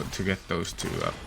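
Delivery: ordinary talk, nothing marked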